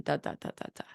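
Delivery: "da, da, da" whispered; whispered reduplication. Retold or imitated. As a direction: whisper the repeated "da". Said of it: "for all done".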